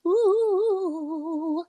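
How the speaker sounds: [name] rock-star voice. Acted. Podcast host playing [name] for the crowd, hammed-up arena shout. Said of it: "the voice is making his way to the stage".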